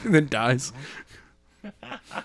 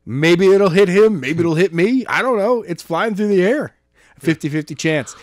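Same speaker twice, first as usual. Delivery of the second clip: carefree gamble; 4 semitones higher, 3 dB louder than usual. Retold or imitated. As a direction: shrug it off like a reckless gambler. Quoting gambler throwing gun.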